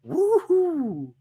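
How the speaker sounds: listener's message read as a big whoop; mock woohoo. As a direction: cheer it out like a delighted fan. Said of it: "Green goes".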